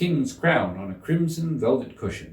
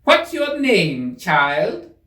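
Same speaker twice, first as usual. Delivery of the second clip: much higher, louder, slow haughty demand; imperious queen; 8 semitones higher, 7 dB louder, much slower than usual. Acted. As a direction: stretch it out high and commanding. Queen questions Alice.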